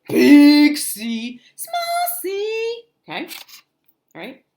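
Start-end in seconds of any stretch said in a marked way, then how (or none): 0.1-1.4 loud, booming contrast to a tiny letter; big-letter voice. Shown as a demonstration. Teacher showing children a big C, big loud voice. 1.6-2.8 very high, drawn-out squeak; tiny small-letter voice. Shown as a demonstration. Teacher showing children a small C, tiny high voice.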